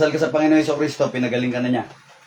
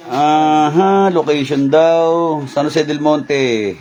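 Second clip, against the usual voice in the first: loud, very slow, stretched-out place names; drawn-out announcing; 5 dB louder, much slower than usual. Real, not acted. Healer telling viewers the location, drawn-out announcement.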